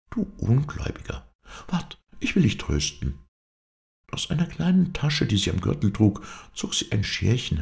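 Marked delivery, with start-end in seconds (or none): 0.1-3.2 a lightly teasing, mischievous reply; playfully roguish. A narrator's reading. Young woman consoling her doubting sweetheart, playfully roguish. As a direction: tease him gently, swinging up high at the start. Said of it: "lächelte sie schalkhaft und sagte".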